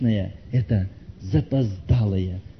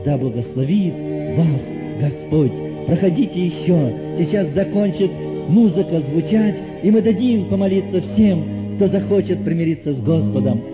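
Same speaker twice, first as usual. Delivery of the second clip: fervent pleading that surges higher on key appeals; impassioned altar call; 6 semitones higher, 5 dB louder than usual. Real, not acted. Preacher invites dear soul to repent now, fervent appeal. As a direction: plead with them to come, swelling and easing.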